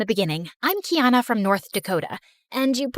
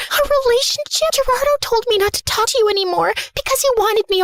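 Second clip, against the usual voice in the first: high-pitched distraught wail; tearful apology; 10 semitones higher, 4 dB louder than usual. Acted. Apologizing to friend Emma after ruining everything, distraught and tearful.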